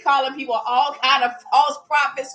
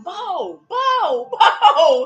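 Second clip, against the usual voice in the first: high calling out with laughter; high laughing calls for Bo; calling the dog; 7 semitones higher, 7 dB louder, much slower than usual. Retold or imitated. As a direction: call the little dog across the yard. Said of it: "I be calling him".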